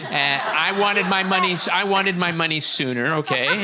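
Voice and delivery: a cheeky laughing confession, pushed loud; laughing joke. Real, not acted. Joking about bat mitzvah money.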